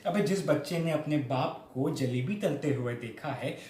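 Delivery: ordinary delivery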